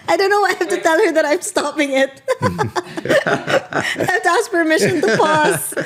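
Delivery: giggling through the words; laughing. Real, not acted. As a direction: laugh while you talk. Giggling at the AI pausing.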